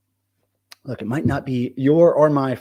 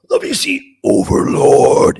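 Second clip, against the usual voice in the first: slow, drawn-out, louder announcer-style Overlord; dramatic Overlord voice; 8 dB louder, slower than usual. Shown as a demonstration. Streamer shows viewers how to say Overlord, slow and booming. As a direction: boom out Overlord like a movie trailer. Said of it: "You gotta say it like that".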